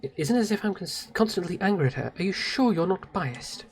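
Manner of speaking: soft, reserved figure; defensive game character. Acted. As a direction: deny it quietly, then question back.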